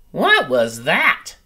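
put-on a baby bird crying out in alarm. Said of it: "cried Flap".

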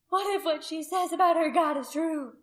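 high, loud, impassioned accusing; ringing high-pitched condemnation; fierce accusation. A narrator's reading. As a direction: denounce them before the crowd. Loud accusation before the crowd.

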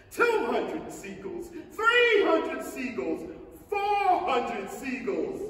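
slow, loud, almost sung count with a laugh; joyful counting shout. Acted. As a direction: crow out each number. James counts seagulls triumphantly.

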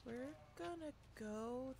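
unvoiced slow whisper. Real, not acted. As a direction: whisper it slowly to stay hidden. Sneaking past.

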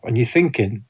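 ordinary talk, nothing marked

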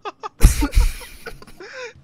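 high, loud cackling laugh; loud burst of laughter. Real, not acted. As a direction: explode into laughter.

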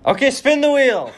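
laughing through the words; high, giggly laugh while speaking. Real, not acted. Laughs at friend's dodged answers.

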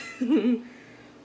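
laugh. Real, not acted. Laughs at her long rant.

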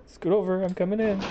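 high, hurried command; urgent. Acted. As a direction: push your way into a hiding place. Scrambling into hiding.

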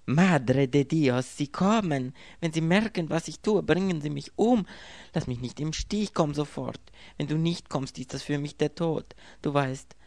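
breathless terror on the phone; panicked plea. A narrator's reading. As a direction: she hears her killers coming, beg for help. Woman fears killers are coming.

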